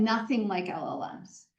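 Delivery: usual speaking voice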